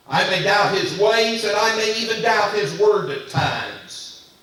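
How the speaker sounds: loud, high, rising preacher's cadence; preaching at full force. Acted. Pastor confessing doubts to the church, in full preaching voice.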